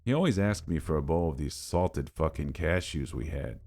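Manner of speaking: ordinary delivery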